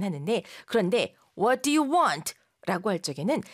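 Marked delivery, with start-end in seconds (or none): 1.4-2.3 blunt demand. Shown as a demonstration. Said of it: "방실방실 웃으면서 하는 말 같진 않죠".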